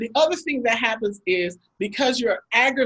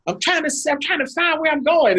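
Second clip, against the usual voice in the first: put-on much higher, exasperated shushing of the kids; mock-snap at the back seat, voice pitched way up; frustrated parent snapping; 8 semitones higher than usual. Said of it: "they don't want anybody talking".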